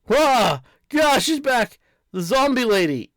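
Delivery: high, loud panicked cry; high-pitched scared yell; frightened shriek. Acted. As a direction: yelp in terror at the zombie lady. Frightened character shrieks at zombie.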